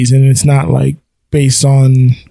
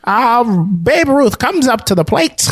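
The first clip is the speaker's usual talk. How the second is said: sports announcer; 8 semitones higher than usual. Pretend. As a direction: call it like an old radio announcer. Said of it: "announcer voice".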